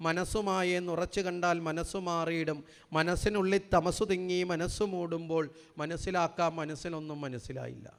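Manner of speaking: gentle recitation of a Vedanta verse; poem recital. Shown as a demonstration. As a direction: recite the poem line by line, unhurried. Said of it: "എഴുതി വച്ചിരിക്കുന്നത്".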